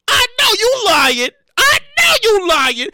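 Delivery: slow, loud disbelieving shout; outraged yell. Pretend. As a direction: call out the lie at full volume. Outraged at one sauce packet.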